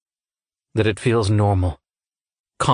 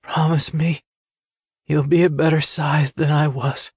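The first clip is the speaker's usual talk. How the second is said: voice getting weaker; 7 semitones higher than usual. Acted. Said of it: "his voice getting weaker".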